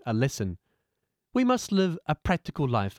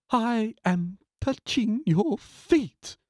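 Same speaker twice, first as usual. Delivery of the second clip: reverent; 8 semitones higher, much slower than usual. Acted. Disciple bows to guru.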